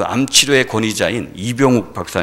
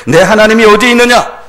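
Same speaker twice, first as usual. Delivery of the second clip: loud, high jeering challenge; mocking taunt; 10 semitones higher, 11 dB louder than usual. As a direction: hurl it like a taunting bystander. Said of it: "주변 사람들이 조롱하는 거예요". (큰 소리로 조롱하듯)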